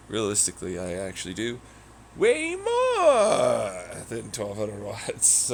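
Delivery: ordinary delivery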